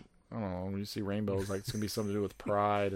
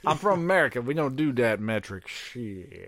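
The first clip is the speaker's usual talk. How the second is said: proud American; 3 semitones higher, 6 dB louder than usual. Pretend. Mock proud American rant.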